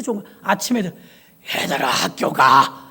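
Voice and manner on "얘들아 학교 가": put-on lower, slow rasping call to the kids; hoarse voice after a night of prayer; hoarse lost voice. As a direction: croak it out with a ruined voice. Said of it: "목소리가 이렇게 된 거야".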